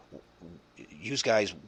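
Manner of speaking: put-on nervous city caller with a Chicago accent; mock Chicago caller, scared of noises. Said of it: "they gotta talk like this".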